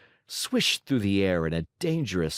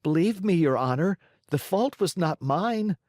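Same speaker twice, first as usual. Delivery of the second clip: pleading; 8 semitones higher than usual. Acted. Pinocchio pleads with the director.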